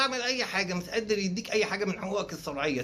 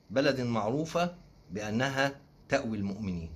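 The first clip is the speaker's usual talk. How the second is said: slow solemn reading of an official statement, lower; mock official statement; 4 semitones lower than usual. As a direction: read it like a grave official announcement. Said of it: "انا معايا اهو النص اللي نشروه". (قراءة بيان رسمي بنبرة جادة وبطيئة)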